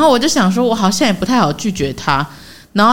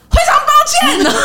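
high, loud shouted sorry; loud apology; 4 dB louder than usual. Retold or imitated. Auntie bumping her on the platform, shouting sorry. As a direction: yell it over your shoulder mid-run.

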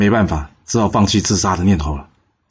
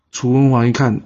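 ordinary delivery

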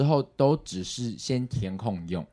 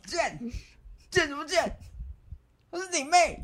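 much higher, exaggerated angry father voice; imitating scolding dad. Retelling being scolded by dad, mock angry father. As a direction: ham up dad's scolding. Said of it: "我就被骂了".

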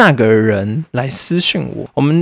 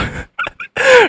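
shrill laugh, much higher; high burst of laughter. Real, not acted. Laughing before the big reveal, high laugh. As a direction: cackle at the twist.

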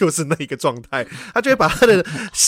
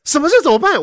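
high-pitched retold outburst; indignant, raised-pitch reenactment; sudden anger; 9 semitones higher, 3 dB louder than usual. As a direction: suddenly catch on and get mad. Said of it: "我就突然生气".

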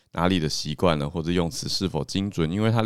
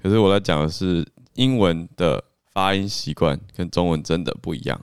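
normal talking voice

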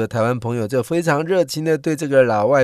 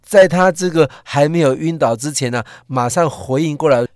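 ordinary talk

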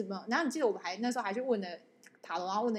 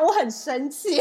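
mock anger; 4 semitones higher, 8 dB louder, much slower than usual.

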